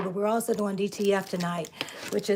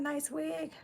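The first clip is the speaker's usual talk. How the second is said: higher, softer, puzzled echo of a comment; amused disbelief at a viewer's remark; surprised question; 7 semitones higher, 8 dB quieter than usual. Real, not acted. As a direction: repeat it in disbelief, rising. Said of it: "is that what that's saying?".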